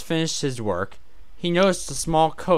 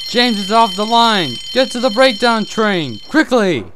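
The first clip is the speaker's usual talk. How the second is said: high, loud shouted orders; urgent alarm call; 10 semitones higher, 8 dB louder than usual. A narrator's reading. Alarm sounded, urgent orders called out.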